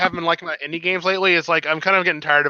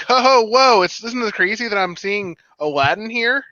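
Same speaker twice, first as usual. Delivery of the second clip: mock game-character voice; 6 semitones higher than usual. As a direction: play a hammy cartoon hero marvelling. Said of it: "imagining if Kingdom Hearts had the American studio".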